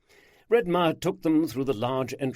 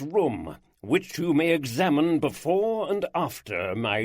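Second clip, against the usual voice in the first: slow, louder, higher proclamation; bolder, every phrase emphasized; 4 semitones higher, 5 dB louder than usual. Acted. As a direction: hammer home each phrase like a ringmaster. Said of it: "his voice was bolder, emphasizing each phrase".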